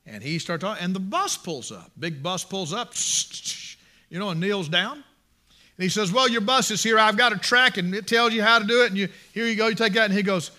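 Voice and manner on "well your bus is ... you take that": high, loud acted hand-off; loud brush-off. Preacher handing over a tract as the bus arrives, loud brush-off. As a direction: rattle off the retold excuse loudly.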